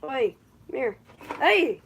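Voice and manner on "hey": sudden loud startled yelp; startled shout. Real, not acted. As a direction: jolt out a surprised hey. Startled by jumping cricket.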